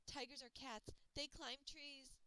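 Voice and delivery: panting while running, high and loud; out of breath. Acted. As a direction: gasp it out mid-sprint. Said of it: "I could barely talk because I was running".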